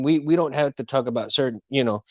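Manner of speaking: ordinary delivery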